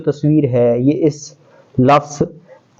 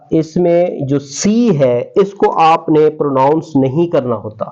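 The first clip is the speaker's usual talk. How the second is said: normal talking voice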